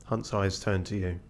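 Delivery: ordinary talk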